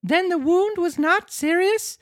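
very high puppet voice, hopeful question; Pinocchio, joyful. Acted. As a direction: ask it as an excited little marionette. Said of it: "jumping around with joy".

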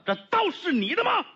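high-pitched accusing question; confronting demand. Acted. Man pointing at stall keeper over a knife, high confronting demand.

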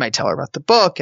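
usual speaking voice

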